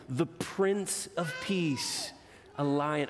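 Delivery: put-on expressive read-aloud to children; gentle storyteller voice for kids; storybook reading. A narrator's reading. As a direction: read it like a bedtime picture book. Reads picture book aloud.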